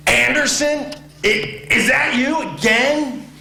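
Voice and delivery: high, slow incredulous coach voice; can't believe it's him again; exasperated coach. Retold or imitated. Coach yells at the freshman again.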